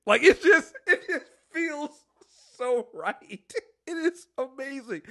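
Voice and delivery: high, giddy gushing; delighted and breaking into laughs; laughing. Real, not acted. Giddy praise right after laughing.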